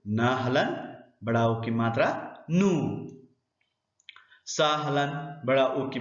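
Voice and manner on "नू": नू with a held oo; long vowel. Shown as a demonstration. Teacher sounds out a spelled syllable, long vowel. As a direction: draw out the vowel of नू. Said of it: "उच्चारण थोड़ा सा लंबा होता है".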